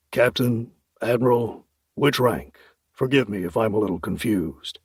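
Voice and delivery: puzzled officer voice; lightly voiced bewildered major; confused major. Major speaks.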